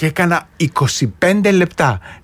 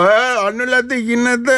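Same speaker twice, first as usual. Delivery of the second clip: mock rebetis; 6 semitones higher than usual. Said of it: "δεν είμαι ρεμπέτης".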